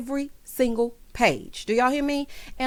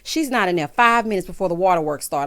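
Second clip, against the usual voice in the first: ordinary talk, nothing marked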